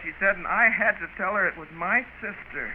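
ordinary talk

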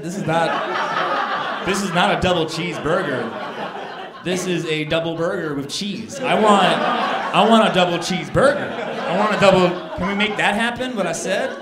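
loud, hammed-up indignation; mock-outraged customer insisting; exasperated protest. Retold argument with the cashier.

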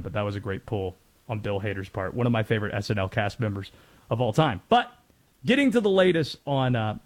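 ordinary delivery

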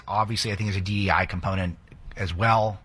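ordinary talk

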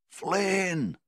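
long, very high, loud holler; yelling for help. A narrator's reading. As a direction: stretch the name out in a desperate yell. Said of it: "he yelled for the other men".

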